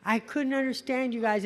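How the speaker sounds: normal talking voice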